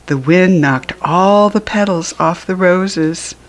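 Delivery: usual speaking voice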